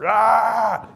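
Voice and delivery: loud, high hype roar; pump-up yell. Retold or imitated. Soldier yelling himself fired up before a patrol, loud roar. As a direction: roar to get yourself fired up.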